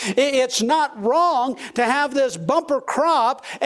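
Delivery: rising pulpit fervour, slowing on key words; full-force preaching. Acted. Pastor exhorting the congregation about the foolish farmer, full-force preaching. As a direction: preach it high and ringing, leaning hard into each point.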